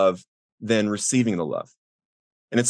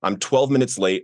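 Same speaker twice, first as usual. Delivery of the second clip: usual speaking voice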